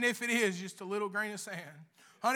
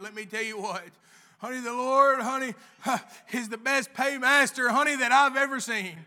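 usual speaking voice

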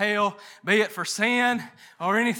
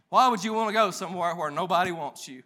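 ordinary delivery

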